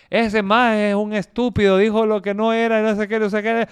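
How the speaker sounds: hammed-up insult from an online critic; mock angry critic. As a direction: sneer it like an angry hater. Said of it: "una cosa es que alguien diga".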